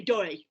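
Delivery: "dirty" with the T gone, like "doy-ee"; almost "doy". Shown as a demonstration. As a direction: swallow the T in "dirty". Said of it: "almost like you say".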